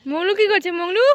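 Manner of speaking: high, loud call. Real, not acted. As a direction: call out across the yard. Calling out a question.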